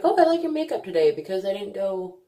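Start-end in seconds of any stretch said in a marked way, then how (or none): 0.0-1.1 high, quick gushing imitation; complimenting admirers. Imitating people praising her makeup, high quick gush. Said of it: "They're like".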